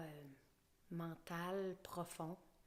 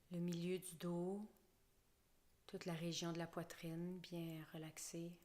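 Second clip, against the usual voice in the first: guided relaxation. Acted. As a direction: lull the listener down into the floor. Guides a body scan relaxation.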